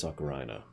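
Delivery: "ocarina" said ah-kuh-RYE-nuh; "ocarina" rhyming its end with "china"; mispronounced ocarina. Real, not acted. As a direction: say "ocarina" with an eye sound in the third syllable. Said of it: "didn't know how to pronounce this word".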